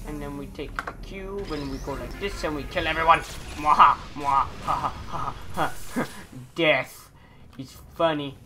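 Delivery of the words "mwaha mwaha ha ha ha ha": loud evil villain cackle; mustache twirling villain. Pretend. Evil laugh after killing everyone.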